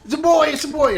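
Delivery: high, loud, elated repeat; overjoyed father. Acted. New father shouting joyfully to his friend, high and loud. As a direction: shout it for joy. Father hears it's a boy.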